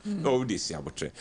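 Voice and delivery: ordinary delivery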